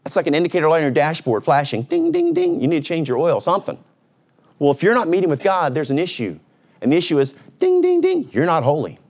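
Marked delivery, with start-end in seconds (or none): 1.8-2.6 high sing-song ding ding; dashboard chime. Retold or imitated. Mimicking a dashboard warning chime. 7.6-8.3 high sing-song ding ding; dashboard chime. Retold or imitated. Mimicking a dashboard warning chime.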